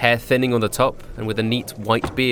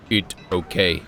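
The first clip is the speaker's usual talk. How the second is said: golem voice; 4 semitones lower, slower than usual. A narrator's reading. Brick golem monster speaks.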